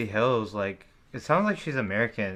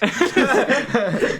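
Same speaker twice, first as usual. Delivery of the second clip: high burst of laughter; cracking up hard; loud laugh; 12 semitones higher, 8 dB louder than usual. Real, not acted. Laughing at sugar mommy joke.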